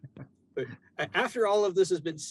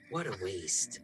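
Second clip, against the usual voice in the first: a lower, softer, more dismissive repeat; Etsa, lower and quieter; 8 semitones lower, 4 dB quieter, slower than usual. Acted. Etsa repeats it to herself, lower and softer. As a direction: let it drop low and soft, a cold aside. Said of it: "Etsa smiles and goes".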